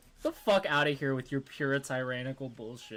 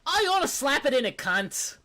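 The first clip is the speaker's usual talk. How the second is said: mock Australian accent; 10 semitones higher, 5 dB louder than usual. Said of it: "Australian sex ed be like".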